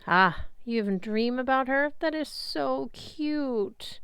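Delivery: a hint of breathy-free swooning goddess, slowed down; cooing goddess. A narrator's reading. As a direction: coo it like she's melting over a crush. Aphrodite cooing over Percy.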